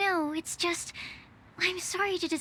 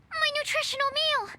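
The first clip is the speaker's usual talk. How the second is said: high, startled cry about the meal; sudden panic over forgotten cooking; alarmed cry; 10 semitones higher than usual. Acted. Companion suddenly remembers the meal cooking, alarmed high cry.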